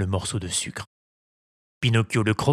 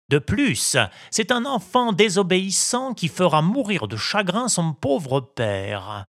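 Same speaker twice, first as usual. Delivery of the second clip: lightly raised scolding cricket; stern cricket; 7 semitones higher than usual. A narrator's reading. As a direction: list his faults sternly. (voix de grillon sévère)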